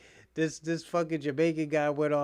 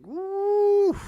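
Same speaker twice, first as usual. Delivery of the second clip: excited whoop, much louder and higher; 9 dB louder than usual. Real, not acted. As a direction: shout a sudden high woo. Sudden shout.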